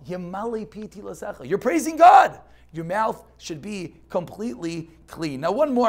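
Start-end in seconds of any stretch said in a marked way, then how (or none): 0.1-2.4 loud, insistent declaration; emphatic shout. Real, not acted. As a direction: hammer the point home loudly.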